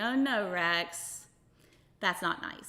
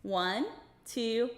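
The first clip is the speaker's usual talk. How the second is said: upbeat, sing-song host voice; kids show host; 4 semitones higher than usual. Acted. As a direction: talk brightly to a room of kids. Host addressing children throughout.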